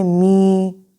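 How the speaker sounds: मी with its vowel held long and level; long free vowel. Shown as a demonstration. Teacher recalling the open syllable example, long drawn-out मी. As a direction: stretch out the vowel of मी. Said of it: "free था".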